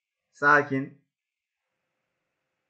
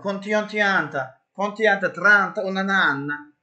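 mock Italian; 7 semitones higher, 4 dB louder than usual.

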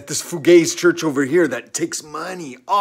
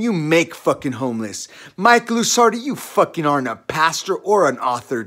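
normal talking voice

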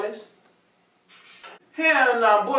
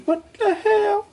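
shocked what the hell; 13 semitones higher, slower than usual. Acted. Burglar tastes prune juice.

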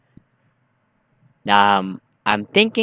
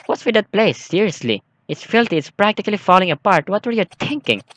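a hint of a nagging woman, voiced higher; 6 semitones higher than usual. Player voicing the game woman scolding about the filthy house. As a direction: read her scolding lines lightly, pitched up.

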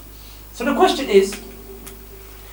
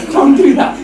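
loud laughing warning; 5 semitones higher, 9 dB louder, slower than usual. Real, not acted.

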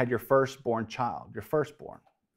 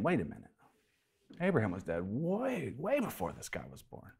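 soft, low muttered aside; inner thought; 3 semitones lower, 12 dB quieter than usual. Acted. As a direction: think it under your breath.

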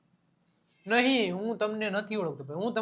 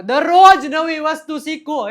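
દરરોજ called out much louder and higher, slowed; emphatic advice; 6 semitones higher, 11 dB louder than usual. Shown as a demonstration. Teacher urging students to learn daily, loud and emphatic. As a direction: urge it, leaning hard on દરરોજ. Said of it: "દરરોજ નવી વસ્તુ શીખો ભાઈ".

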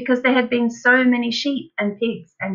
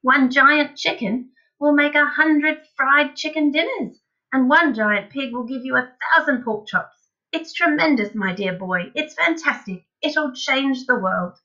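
high, swooping enthusiasm; excited boasting; 6 semitones higher, 4 dB louder than usual. A narrator's reading. Father dazzled by giant animals, exclaiming excitedly. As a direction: reel off the wonders grandly. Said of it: "cried Mister Cranky, waving his arms".

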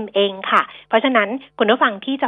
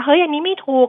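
higher-pitched imitated listener protest; mock objection; 6 semitones higher, 3 dB louder than usual. Host voices a listener spotting wrong information, mock protesting.

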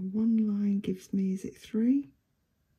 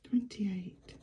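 whispered number; whispering; 2 semitones higher, 5 dB quieter than usual. Real, not acted. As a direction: hush the number out.

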